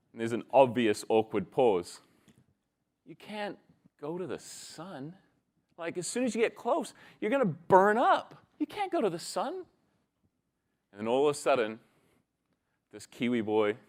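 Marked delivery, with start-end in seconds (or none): 3.0-9.5 starts hushed, climbs to high-pitched disbelief; incredulous Texan boy. Acted. Texan boy correcting Kiwi boy, hushed then high incredulous protest. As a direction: protest like a baffled kid, voice rising high.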